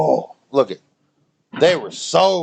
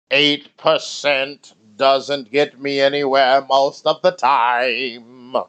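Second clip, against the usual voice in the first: slow drawl; much slower than usual. Real, not acted. Drawn-out tipsy boast.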